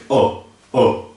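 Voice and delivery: "Ó, ó" made further back in the throat; throaty vowel. Shown as a demonstration. Teacher showing where the vowel sits, back-of-throat "Ó". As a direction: pull the "Ó" inward. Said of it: "um pouco pra dentro".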